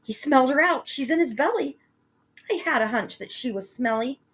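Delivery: put-on high, loud boy fading off; excited cry. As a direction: cry out, then trail to a smirk. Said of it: "Jack cried".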